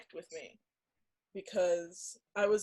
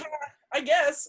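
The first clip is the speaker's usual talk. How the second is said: mock shrugging laugh-off; 6 semitones higher, 6 dB louder, slower than usual. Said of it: "I used to laugh at that 'cause I was just like".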